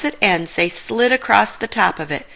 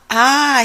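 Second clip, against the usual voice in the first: high, loud short scream of fright; short frightened yell; startled scream; 6 semitones higher, 5 dB louder than usual. Acted. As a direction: jump and yelp. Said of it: "he screamed".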